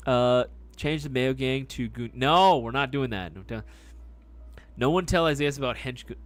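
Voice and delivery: normal talking voice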